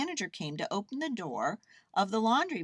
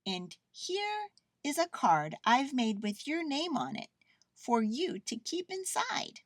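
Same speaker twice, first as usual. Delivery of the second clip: lightly voiced girl; higher-pitched, offering a gift; Lisa; 9 semitones higher than usual. A narrator's reading. Girl gives her bear a name card, kindly.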